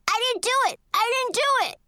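high, frantic scream of denial; child's scream. A narrator's reading. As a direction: scream it high like a scared little kid. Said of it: "rolled into a ball and screamed".

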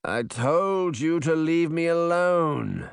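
high, slow, drawn-out groan; pained, dragging complaint; groaning protest. A narrator's reading. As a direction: moan it slowly, wanting to be left alone. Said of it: "He groaned and closed his eyes".

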